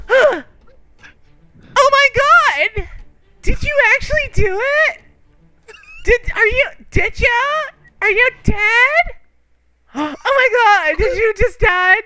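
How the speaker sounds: high, loud panicked cries over his body; frantic girlfriend. Acted. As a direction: shriek like she just found him dead. Girlfriend finds him dead.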